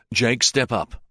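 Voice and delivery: usual speaking voice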